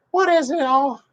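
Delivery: high falsetto question; imitated woman. Woman answering her fiancé's news, high imitated voice. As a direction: squeak it out as the woman.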